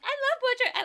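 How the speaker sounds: squealed high and loud; gushing Kyle impression. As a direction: gush like a starstruck fan, up high. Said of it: "Kyle's like".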